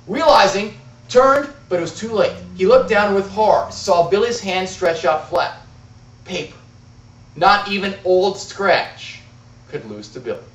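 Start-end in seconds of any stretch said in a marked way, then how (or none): none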